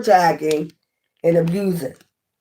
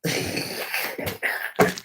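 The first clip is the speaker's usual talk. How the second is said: whispered laugh; 11 dB quieter than usual. Real, not acted. Laughing at the cult gossip.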